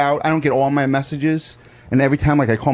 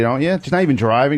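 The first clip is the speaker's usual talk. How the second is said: ordinary talk, nothing marked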